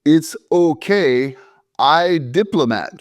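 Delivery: put-on slow, deliberate broken English in a foreign accent; mock foreign accent, drawn out. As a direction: spell it out slowly like he's talking to a child. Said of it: "so he slowed down and he said".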